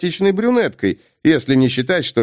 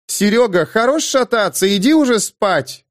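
calling out; 7 semitones higher than usual. A narrator's reading. Called from the kitchen.

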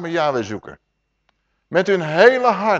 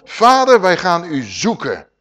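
loud, high cry of Vader; fervent call; 3 semitones higher, 8 dB louder, slower than usual. Acted. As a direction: call out to the Father with fervour. Voices the people calling to God.

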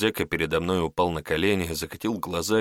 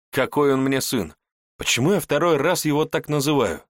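plain reading voice

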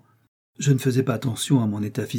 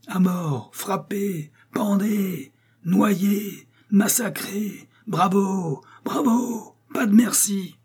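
high-pitched strained mob cries; cris de foule; 10 semitones higher, much slower than usual. A narrator's reading. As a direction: cry out like a bloodthirsty mob heard from afar. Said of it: "Ces cris montaient vers moi". (cris aigus d'une foule déchaînée)

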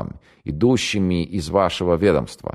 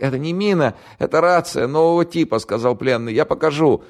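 frightened prisoner; 12 semitones higher than usual. Acted. Captured radio operator to the interrogator, high panicky pleading. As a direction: babble it out in terror.

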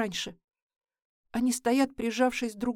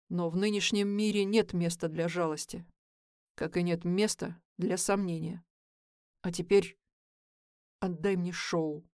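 calm; 5 semitones lower, 4 dB quieter than usual. Acted. Nick's cold calm speech.

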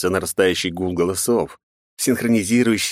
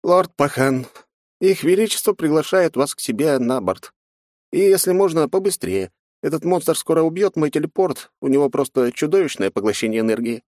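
sad voice; 8 semitones higher than usual. Acted. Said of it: "знакомый грустный голос".

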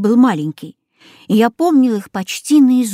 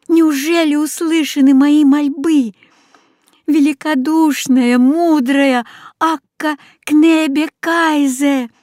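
hopeful plea; 7 semitones higher, 3 dB louder than usual. A narrator's reading. Boy hears geese, hopeful plea.

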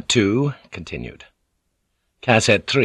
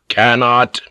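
sharp indignant echo; lordly flash of temper; flaring anger; 3 semitones higher, 5 dB louder than usual. A narrator's reading. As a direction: throw the word back, affronted. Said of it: "Toranaga flared".

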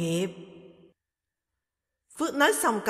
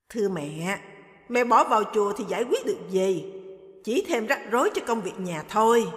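plain reading voice

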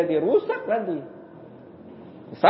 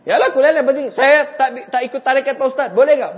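voicing a congregant; 6 semitones higher, 6 dB louder than usual. Congregant asks the ustadz.

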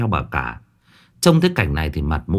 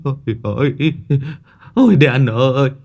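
wailing lament; 5 semitones higher than usual. A narrator's reading. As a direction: howl with grief over the dog. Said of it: "rú lên". (rú lên khóc thương con chó)

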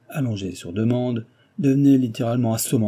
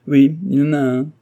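eerie sick man; 5 semitones higher, 8 dB louder than usual. Acted. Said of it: "un sourire à faire claquer les os".

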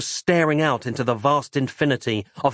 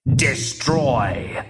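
Richard Burton voice. Acted. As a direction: boom the order like a tempting god. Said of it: "the Richard Burton voice".

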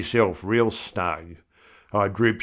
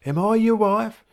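much higher wife voice; an indignant wife, higher; wife's voice; 13 semitones higher than usual. A narrator's reading. Wife scolding husband Bill, much higher.

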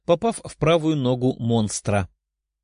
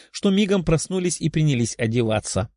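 plain reading voice

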